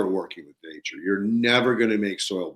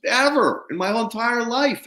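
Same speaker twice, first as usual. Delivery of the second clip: higher, lightly voiced amazed farmer; imitating the farmer; 11 semitones higher than usual. [name] reporting his old neighbor's amazement, high-pitched. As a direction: tell it like wide-eyed news.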